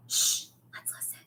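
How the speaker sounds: hushed whisper. A narrator's reading. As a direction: whisper so no one hears. Hiding from pursuers in dark.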